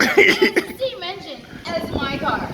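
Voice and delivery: imitating the friend's excited version. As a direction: brag eagerly about the engine. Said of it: "you're like".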